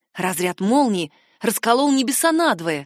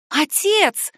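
put-on higher-pitched girl's call; excited cry; 6 semitones higher than usual. A narrator's reading. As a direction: cry out to father. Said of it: "крикнула Корделия". (радостный крик девочки)

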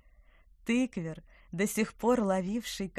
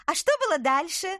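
high creature voice; 11 semitones higher, 8 dB louder than usual. Acted. Eager apple creature asks.

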